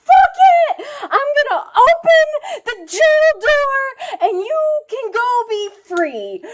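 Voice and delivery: high, loud burst easing off at the end; hammed-up crying outburst; tearful resolve. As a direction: blurt it out in mock anguish. Acts the sister's release.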